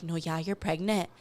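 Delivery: retold, low serious delivery; dead serious. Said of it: "she was so serious".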